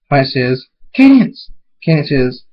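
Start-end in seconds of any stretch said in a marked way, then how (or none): 1.0-1.5 high, loud greeting; delighted exclamation; joyful call. Acted. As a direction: squeal a dear friend's name. Friends reunited.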